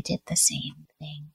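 soft breathy whisper, half to herself; confiding whispered admission; whispered aside. Real, not acted. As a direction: drop to a whisper for the repeat. Repeats her own line as aside.

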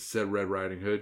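plain reading voice

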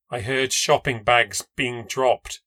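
ordinary delivery

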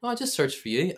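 high-pitched, loud mock cheeriness; retold breezy reply. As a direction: imitate the manager, high and loud. Manager's cheerful reply acted out.

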